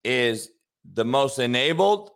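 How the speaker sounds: slow, deliberate, low pauses; slow dramatic delivery. Acted. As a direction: draw it out for drama.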